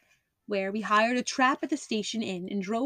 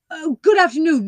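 higher, much louder greeting; hearty greeting; 7 semitones higher, 10 dB louder than usual. Acted. Holmes calls a loud hearty greeting to Miss Stoner. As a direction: call the greeting out across the field.

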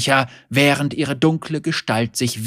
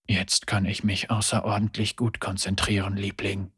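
much softer, low muttering; wry murmur; 5 semitones lower, 7 dB quieter than usual. A narrator's reading. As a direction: mutter it wryly to himself. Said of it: "murmelte Lucian vor sich hin".

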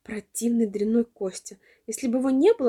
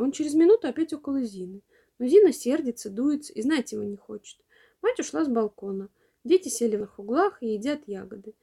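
usual speaking voice

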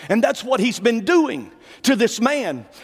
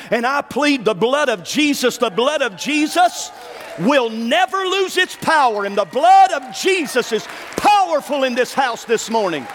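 preaching at full force; 3 semitones higher than usual. Real, not acted. Preacher declares the blood's power to the congregation, shouting. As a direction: proclaim the blood of Jesus, raising the voice.

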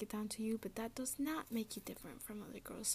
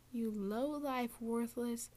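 usual speaking voice

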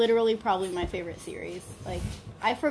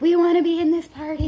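dog voice; 7 semitones higher, 6 dB louder than usual. Retold or imitated. Dogs voiced wanting to join.

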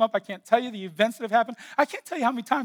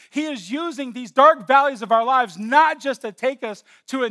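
driving, raised sermon voice; preaching at full force; 5 semitones higher, 9 dB louder than usual. Acted. Pastor preaching to his church, full force. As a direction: build it up like a pulpit preacher.

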